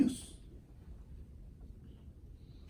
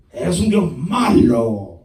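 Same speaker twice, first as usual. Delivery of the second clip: mock accusation; 5 semitones lower, 5 dB louder, much slower than usual. Servant accusing God, repeated.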